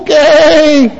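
high, drawn-out sheep voice; high bleated agreement; sheep replying. Acted. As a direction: answer as an eager cartoon sheep. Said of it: "And they'll go".

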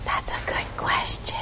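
whispered reply. Real, not acted. Pastor answering a child's question, whispered. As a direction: answer softly, all breath and no voice. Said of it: "Why are we whispering?".

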